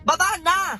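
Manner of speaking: high, exclaimed order; angry command. Acted. Driver kicking the two women out, angry command. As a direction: snap it out high and impatient.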